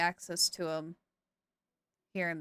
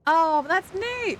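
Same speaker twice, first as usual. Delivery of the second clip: higher, louder, slow appreciative oh; pleased; 8 semitones higher, 6 dB louder than usual. Real, not acted. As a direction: savor the discovery. Pleased by the explanation.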